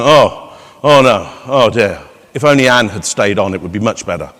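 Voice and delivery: mildly mopey pessimistic mutter falling away; gloomy inner voice. Retold or imitated. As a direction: voice the doubtful little voice in their head. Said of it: "maybe it's got a downward intonation about it".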